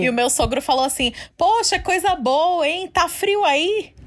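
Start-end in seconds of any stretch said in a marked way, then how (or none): 1.4-3.9 a much higher, slow, awkward small talk; a lightly mocked awkward father-in-law; imitating father-in-law. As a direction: voice the father-in-law, drawing out "tá frio aí". Father-in-law's awkward reply.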